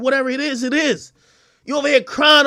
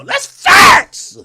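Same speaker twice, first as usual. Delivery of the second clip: a high, loud shouted punchline; 5 dB louder than usual. Real, not acted. Hyping his own roast, a loud high shout. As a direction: yell it up high like a crowd hype. Heated rant roasting a rapper.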